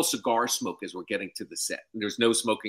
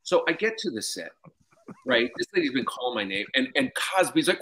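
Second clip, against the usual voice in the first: usual speaking voice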